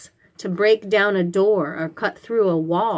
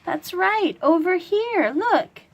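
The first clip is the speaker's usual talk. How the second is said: excited praise; 9 semitones higher than usual. Real, not acted. Teacher praising a child who found the picture, excited. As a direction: cheer them on for spotting it.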